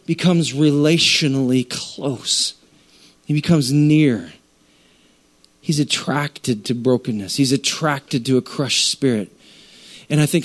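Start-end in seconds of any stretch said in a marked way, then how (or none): none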